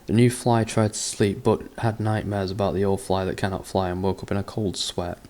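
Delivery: ordinary delivery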